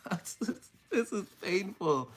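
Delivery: laughing through emotion. Real, not acted. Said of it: "I'm emotional right now".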